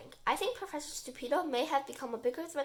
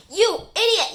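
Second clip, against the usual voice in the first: loud, high outburst; angry yell; 8 semitones higher, 14 dB louder, slower than usual. A narrator's reading. Terry to the professor over the lost machine, yelling angrily. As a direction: yell the insult in outrage. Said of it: "yells Terry".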